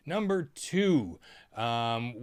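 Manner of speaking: ordinary talk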